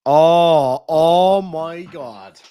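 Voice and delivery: loud stunned oh, then slow dragging oh my God; real disbelief, drawn out; shocked. Real, not acted. Caught out live on stream, shocked and slow.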